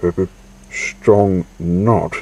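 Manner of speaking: ordinary delivery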